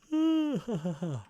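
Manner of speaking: long, high yawn trailing off; big yawn. Real, not acted. Apologising to viewers mid-stream, big yawn. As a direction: yawn wide and let it out. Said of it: "big yawns".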